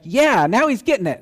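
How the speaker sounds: loud and high, cheering him on; eager cheer, now he's getting it; excited approval. Retold or imitated. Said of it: "they're like".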